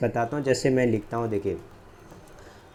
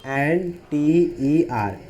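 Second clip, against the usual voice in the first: each letter drawn out slowly; slow spelling out; much slower than usual. Shown as a demonstration. Teacher spelling a word slowly for students. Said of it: "मैंने लिखा ई".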